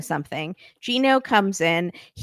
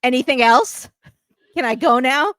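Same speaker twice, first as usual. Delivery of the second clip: dismissive retort; 4 semitones higher, 6 dB louder than usual. Retold or imitated. Acted dismissive reply to pregnancy news.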